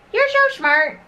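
very high proud coo. Real, not acted. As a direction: owner praising her puppy. Praising the puppy.

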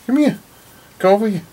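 high coaxing call to a dog. Real, not acted. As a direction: pitch it up high and call the little dog over. Calling the dog over.

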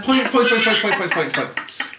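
rapid-fire mock rattle, tailing off; throwing points out. Quizmaster mimicking himself handing out points, mock rattle. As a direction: rattle the word off, fading at the end. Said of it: "He just throws points out now like that".

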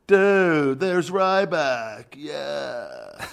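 high, slow dazed stoner voice. Pretend. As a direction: drawl it like a baked stoner. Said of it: "That's my stoner voice".

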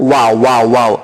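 "ওয়া, ওয়া, ওয়াও" sounded out with a wa glide; pronunciation demo. Shown as a demonstration. Teacher showing learners how waw is pronounced, "ওয়া, ওয়া, ওয়াও" sounded out. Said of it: "বা এর মতন উচ্চারণ হবে".

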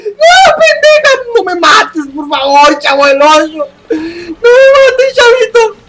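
high, slow desperate pleading cries; terrified begging. Real, not acted. Gamer begging the monster not to kill him, high desperate cries. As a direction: plead for your life, near tears.

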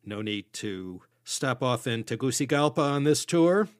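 ordinary talk, nothing marked

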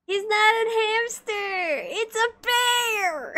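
high, loud, slow fake wail; a hammed-up sob in defence of the drawing; mock crying. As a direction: whine it like a wounded child, hammed-up. Defending her bear drawing playfully.

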